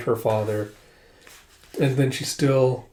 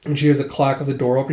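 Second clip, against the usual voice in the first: ordinary delivery, nothing marked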